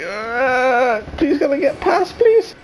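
very high, whimpering plea; desperate pleading. Real, not acted. Player begs to get past an android, desperate pleading. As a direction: beg in a squeaky near-cry.

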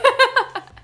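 bright high giggle; high laugh. Real, not acted. Laughs at own accent mishmash.

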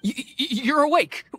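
high, stammering surprise; startled stammer. Acted. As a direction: jump back, tripping over the first word. Miner finds stranger waking up.

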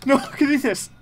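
real jump-scare shriek, very high and loud; high-pitched panicked yelling; startled scream. Real, not acted. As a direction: scream and curse in sudden fright. Jump scare during horror game.

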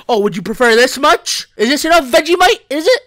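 high, loud mock questioning; indignant sarcasm. Real, not acted. Player answering complaint about too little Vegemite, indignant sarcasm. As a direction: throw the questions back, rising to a high shout.

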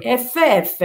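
ordinary delivery

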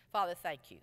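soft, earnest prayer voice; hushed closing prayer. Acted. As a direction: pray quietly before the congregation. Leads a prayer.

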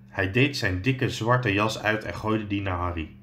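ordinary delivery, nothing marked

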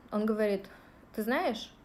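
ordinary talk

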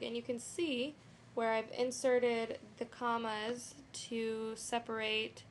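ordinary talk

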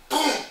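sudden loud, high "boom"; one explosive burst; imitated explosion. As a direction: punch out the blast. Imitates the blast.

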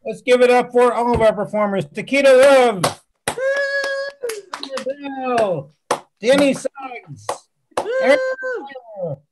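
loud MC calling for applause; big, projected stage outro; show host announcer. Real, not acted. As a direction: belt out the performers' names to the crowd. Host calls out performers for applause.

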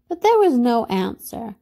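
ordinary delivery, nothing marked

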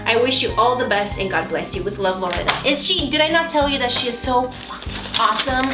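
ordinary talk, nothing marked

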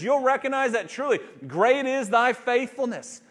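loud, high, impassioned preaching; preaching at full force. Acted. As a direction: drive the message home to the congregation.

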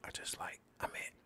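usual speaking voice

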